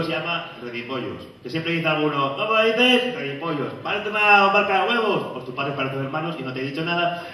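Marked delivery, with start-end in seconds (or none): 2.4-5.2 higher, slow mocking voice; a hint of a smug outsider. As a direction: tease it like a smug stranger. Outsider mocks town name.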